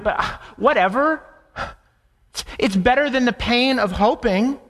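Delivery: high-pitched, acted sarcasm turning to bitter complaint; scornful Sarah. Sarah giving up on the promise, bitterly dismissive. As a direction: imitate someone who has given up, defensive and dismissive. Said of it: "Sarah is saying".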